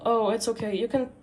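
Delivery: usual speaking voice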